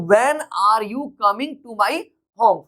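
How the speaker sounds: word by word, slowly spaced; teacher's slow dictation pace. Shown as a demonstration. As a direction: spell it out slowly for students. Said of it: "teacher dictating slowly".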